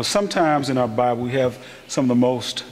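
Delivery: ordinary talk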